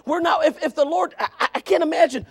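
high, loud pulpit appeal; preacher pleading at full voice; full-force preaching. Real, not acted. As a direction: press the question on the congregation, loud and high.

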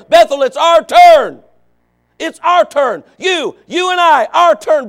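loud, high preaching shout; preaching at full force. Real, not acted. Preacher shouting to his church, full force. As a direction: drive it home to the congregation.

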